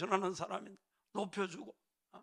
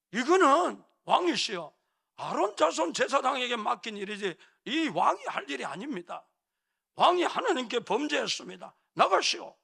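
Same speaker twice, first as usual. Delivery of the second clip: loud, high-pitched formal rebuke; priests confronting king; 4 semitones higher, 5 dB louder than usual. Acted. Priests blocking the king from burning incense, loud stern rebuke. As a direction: call out sternly, building to a raised command to leave. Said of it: "힘센 제사장들이 막잖아요".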